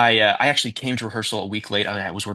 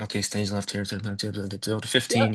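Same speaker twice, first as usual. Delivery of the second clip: muttered private run-through of stage directions; muttering to oneself; 5 dB quieter than usual. Acted. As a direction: mumble lines under your breath. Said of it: "muttering to yourself".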